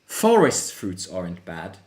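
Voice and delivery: ordinary talk, nothing marked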